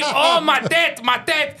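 high, slow mock moaning; mock whining. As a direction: mimic her whimpering over her head. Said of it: "He's like, tu me dis".

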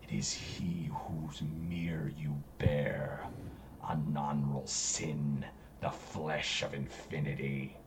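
low, sinister eldritch whisper; whispering entity. Acted. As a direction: hiss it like an ancient evil.

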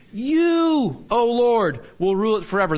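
You punched high and loud, then O Lord; emphatic on You. Shown as a demonstration. Teacher reading the verse to his class, stressing You. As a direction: lean hard on You to show it comes first. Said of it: "The pronoun comes first".

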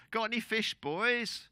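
high, projected call to men offshore; calling across water. Acted. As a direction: holler it from the beach. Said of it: "a voice comes across the water".

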